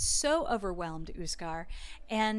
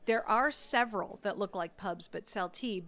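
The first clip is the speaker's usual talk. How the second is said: usual speaking voice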